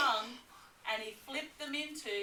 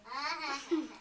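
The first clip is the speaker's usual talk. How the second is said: gleeful cackle; 4 semitones higher than usual. Acted. Villain laugh after the line.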